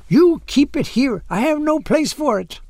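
put-on high-pitched, insistent accented woman; forbidding. A narrator's reading. Old woman halts pastor reaching for tapestry, high forbidding insistence. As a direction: refuse firmly, pitched well up. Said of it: "she forbade him, raising her hand to halt him".

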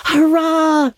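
high, bursting hurrah; carried-away cheer. A narrator's reading. Moody Spurgeon cheers Miss Stacy's staying, carried away. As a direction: let the cheer fly out of him. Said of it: "carried away by his feelings".

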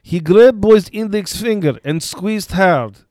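put-on lightly done Russian accent. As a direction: read the report with a Russian accent. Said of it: "should I do this with a Russian accent?".

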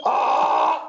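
voiceless breathy bray, pushed out hard; airy imitation of a donkey braying; donkey bray on request. Shown as a demonstration. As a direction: blow out a toneless donkey bray. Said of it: "A ver, haz otra vez".

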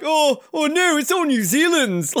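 high-pitched mock refusal; Nico. Skier refusing interviewer any share, high mock protest. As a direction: imitate the skier, voice pitched right up. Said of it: "And he's like".

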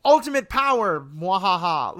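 sneering panto villain. Acted. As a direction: gloat like a cartoon overlord. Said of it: "almost Emperor Palpatine".

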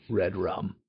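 low soft creepy Red Rum; creepy whisper. Pretend. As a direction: breathe it like a ghost. Famous creepy phrase.

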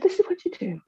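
high, sing-song carer voice talking down; mock a patronising day centre worker; condescending carer. Day centre worker offers decoupage, high patronising sing-song. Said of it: "she said".

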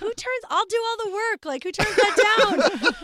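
incredulous at the refusal; wife scoffing at husband turning her down; mock exasperated. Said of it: "I'm like".

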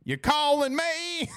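put-on high, slow little-kid twang; slow high child's twang; kid's twang. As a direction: mimic the son drawing it out. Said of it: "their little twang to him".

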